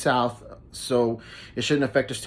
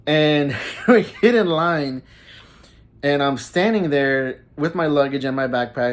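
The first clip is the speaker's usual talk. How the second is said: normal talking voice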